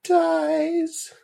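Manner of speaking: high, halting mum voice; hesitant grief. Acted. Mum barely able to say dies, high and halting. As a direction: force out the word dies. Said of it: "found it almost impossible to say the word".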